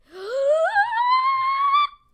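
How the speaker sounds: very high, long loud squealing gasp; fan squeal. Acted. Deku opens the autographed notebook, squealing. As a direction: squeal long and piercingly with delight.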